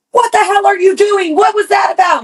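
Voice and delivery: dad's high shouted scolding; dad yelling. Retold or imitated. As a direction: yell it like a furious father.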